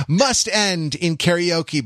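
ordinary talk, nothing marked

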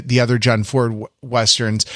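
usual speaking voice

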